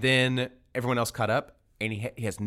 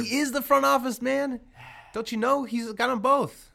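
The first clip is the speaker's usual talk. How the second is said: higher, pressing the point; excited insistence; 11 semitones higher than usual. Real, not acted. Arguing basketball with friends, high and emphatic. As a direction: come in hot, you know you're right.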